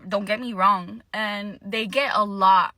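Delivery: ordinary talk, nothing marked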